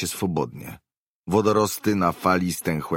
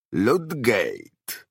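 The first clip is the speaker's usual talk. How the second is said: understated roar, higher and drawn out; old man's roar; 4 semitones higher than usual. A narrator's reading. Old man roars his name at her question, understated roar. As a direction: roar the name with some restraint. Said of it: "ryknął starzec".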